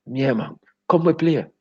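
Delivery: high-pitched mocking imitation; high caricature of a keen footballer; Mikey. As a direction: call out in a high put-on voice. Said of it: "named Mikey".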